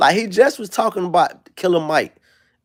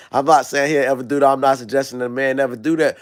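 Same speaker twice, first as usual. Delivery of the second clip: ordinary delivery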